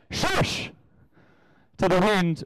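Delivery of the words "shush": long voiceless shush; mock shushing. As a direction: shush the wind as a demonstration. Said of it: "I walked outside my house and went".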